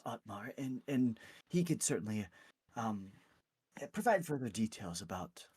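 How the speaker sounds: lightly voiced, hesitant cleric; halting, polite suggestion from a timid helper; flustered clergy member. Clergy member suggests seeing the priest.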